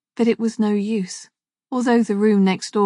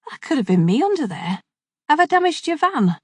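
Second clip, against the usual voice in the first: lightly voiced Scouse cyclist, rising on the question; a hint of Liverpool, worried about the van; mild Scouse accent; 8 semitones higher than usual. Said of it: "spoke in a Scouse accent".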